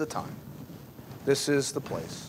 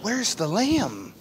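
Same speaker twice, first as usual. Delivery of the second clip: slow, tender question from a trusting son; a trusting son gently asking his father; gentle and loving; 7 semitones higher, slower than usual. Shown as a demonstration. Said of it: "says in a gentle and loving way".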